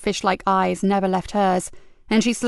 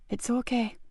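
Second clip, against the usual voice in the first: much softer calm voice; soft reassurance; 3 semitones higher, 9 dB quieter than usual. Acted. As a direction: soothe her gently. Paramedic soothes.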